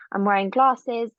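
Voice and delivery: usual speaking voice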